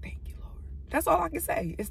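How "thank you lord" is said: unvoiced, hushed thanks; whispered prayer. Real, not acted. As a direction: breathe the thanks to God. Whispered prayer after crying.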